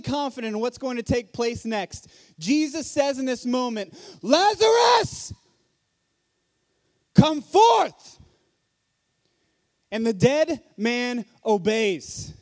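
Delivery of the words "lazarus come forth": slow, very high and very loud full-force cry; thunderous shout. Shown as a demonstration. Jesus calls the dead man from the tomb, booming shout. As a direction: roar it like thunder, totally confident. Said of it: "in an extremely loud voice".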